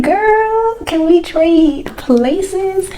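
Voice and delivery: high, slow gushing plea; envious gushing. Retold or imitated. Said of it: "I'm like".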